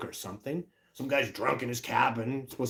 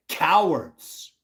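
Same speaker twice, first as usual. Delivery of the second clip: angry outburst; 4 semitones higher, 7 dB louder than usual. Real, not acted. Angry rant about university cowards.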